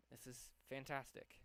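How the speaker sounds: ordinary talk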